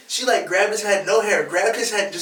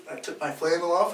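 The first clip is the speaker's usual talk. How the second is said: usual speaking voice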